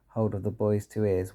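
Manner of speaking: usual speaking voice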